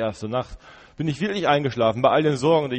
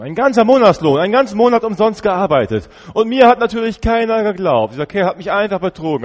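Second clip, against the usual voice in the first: louder and much higher, fuming at the injustice; indignant outrage; 9 semitones higher, 9 dB louder than usual. Acted. Prisoner fuming about the man who cheated him, loud outrage. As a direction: rant it, boiling over at being cheated.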